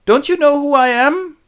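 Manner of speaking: put-on high, loud, strange old-man voice; pleading Lear. Lear asks Cordelia if she knows him, high plea. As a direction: plead to be recognised.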